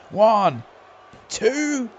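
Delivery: rising, loud count and cry; excited pin count. Real, not acted.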